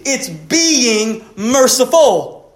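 "being" drawn out very slowly and leaned on; stress on being. Acted. Preacher stressing "being" over doing, very slow. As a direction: linger hard on "being".

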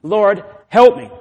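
loud, high plea; crying out. Acted. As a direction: call on the Lord urgently. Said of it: "you cry out to him".

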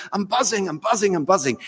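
put-on eager, lightly Scottish-accented repetition. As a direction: imitate a fan repeating himself in a Scottish accent. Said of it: "almost has to be in a Scottish accent".

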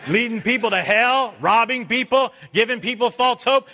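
loud, high denunciation; fiery preaching. Real, not acted. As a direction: hammer each phrase home. Preaching at full force.

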